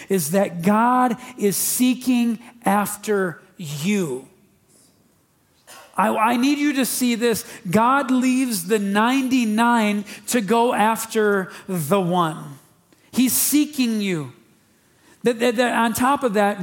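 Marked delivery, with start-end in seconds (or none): 6.0-16.6 high, loud, driving sermon voice; full-force preaching. Acted. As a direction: preach it out to the whole room.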